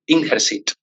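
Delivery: normal talking voice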